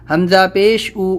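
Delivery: normal talking voice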